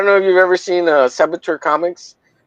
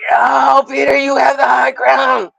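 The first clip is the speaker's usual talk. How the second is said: high, shouted mock terror; mock pleading; 10 semitones higher than usual. Streamer begging Peter in the duel, high and shouted. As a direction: beg for mercy like a beaten villain. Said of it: "he tells me".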